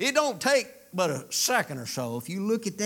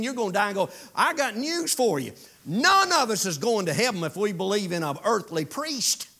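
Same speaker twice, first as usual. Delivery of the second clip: louder, punchy pulpit delivery; preaching; 5 semitones higher, 4 dB louder than usual. Acted. Preacher warning the congregation, emphatic pulpit preaching. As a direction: drive each line home to the church.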